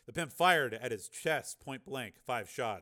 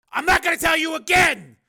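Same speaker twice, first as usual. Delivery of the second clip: high, very loud threat; shouted warning; 18 dB louder than usual. Acted. Steele giving the pimp a last warning, shouted. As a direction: warn at full force, pitched far up.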